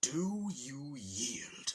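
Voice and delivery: very slow, weighty question; solemn demand. Retold or imitated. Penguin leader asking Bowser to surrender, very slow solemn voice. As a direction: drag out a grave ultimatum.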